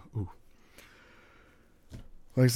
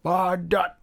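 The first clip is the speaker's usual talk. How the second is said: hammed-up curse at a TV dot, higher; mock cursing; 5 semitones higher, slower than usual. Telling a friend about the dot on his TV, mock cursing. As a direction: shake a fist at the dot, half-joking.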